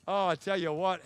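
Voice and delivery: ordinary delivery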